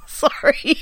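real giggle through the apology; laughing. Real, not acted. Laughing apology after corpsing.

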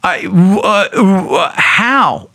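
lost for words after a shock; dumbfounded. Retold or imitated. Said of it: "I'm like".

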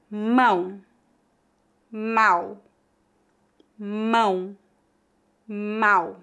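Mão nasal, then Mal with an open oral A; contrast nasal and oral. Shown as a demonstration. Teacher contrasts the two words for repetition. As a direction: alternate Mão and Mal clearly. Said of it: "pronunciar as duas palavras para você ver melhor a diferença".

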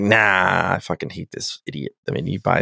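low, very soft grumbled dismissal; imitated fed-up muttering; dismissive mutter. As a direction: wave it off under your breath. Retold dismissive Picard reply.

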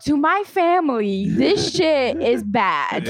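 slow, emphatic disapproving parent; strict family voice. Retold or imitated. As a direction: drag it out like a scandalized elder. Voicing strict Haitian family.

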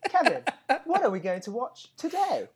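hammed-up TV banter opener; cheesy host voice. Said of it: "if our banter was like that".

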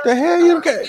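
high, loud cry of disbelief; shocked outburst. Real, not acted. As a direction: yelp in disbelief.

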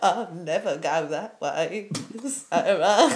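a low, flat mock robot voice. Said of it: "He sounds like a Dalek".

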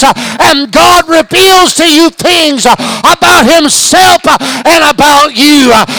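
climbing from firm to loud, high shouting; full-force preaching. Real, not acted. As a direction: preach it harder and harder to the crowd.

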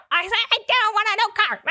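high, fast, exasperated protest; shrill-free but high mock outburst at her husband; exasperated refusal. Wife refusing husband's truck, high fast exasperated.

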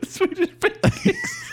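squeaky giggling; laughing. Real, not acted. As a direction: crack up mid-word. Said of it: "Zach's dying".

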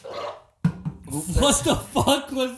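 high, slow, stunned; shocked. Real, not acted. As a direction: drag it out in disbelief. Reacting to wet burp.

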